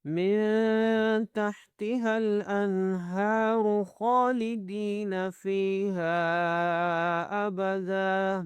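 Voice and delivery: slow, drawn-out tajweed chant; Quran recitation. Shown as a demonstration. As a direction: recite with long held vowels.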